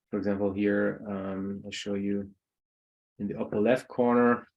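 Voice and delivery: usual speaking voice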